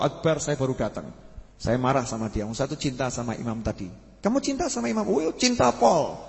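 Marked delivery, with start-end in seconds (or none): 4.2-5.1 high-pitched acted disbelief; incredulous question. Holy man questions the jinn. 5.1-6.2 high, drawn-out acted avowal; hammed-up slow profession of love; emphatic declaration.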